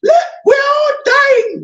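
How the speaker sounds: frantic high wailing shout, voice cracking into a cry; a mock meltdown, shrieking that all is lost; panicking leader. Leader panicking in front of his team, frantic crying shout. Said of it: "don't go there and say".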